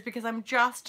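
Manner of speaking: ordinary talk, nothing marked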